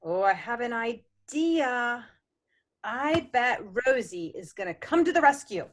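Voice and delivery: ordinary delivery, nothing marked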